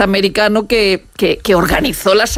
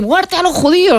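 higher-pitched imitation of a shout; mock crowd cry, raised pitch but little extra volume; retold crowd cry; 9 semitones higher than usual. Recounting what people cried at a funeral, imitated shout. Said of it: "grita ahí".

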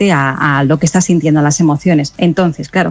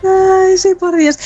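emphatic; 13 semitones higher, much slower than usual. Real, not acted. Heartfelt agreement with the plea.

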